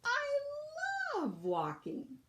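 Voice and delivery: starts very high, delighted; delighted beaver. A narrator's reading. Beaver telling Bird it loves walking, starting very high. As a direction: gush about loving walking.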